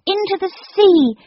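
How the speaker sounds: high, loud frightened gasp; gasped cry. A narrator's reading. Jane gasping to the others, terrified. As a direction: gasp it out in terror. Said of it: "she gasped".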